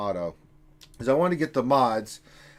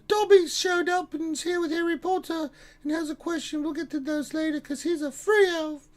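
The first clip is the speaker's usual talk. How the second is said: much higher, squeaky elf voice; house-elf voice. Pretend. Streamer voicing an elf reading chat, high squeaky elf.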